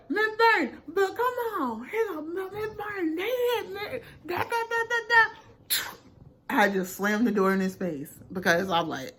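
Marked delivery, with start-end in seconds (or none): 0.1-5.3 high, whining crybaby voice; whiny crying boy. Retold or imitated. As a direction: play the boy whining that they hit him. Crying boy begging at door.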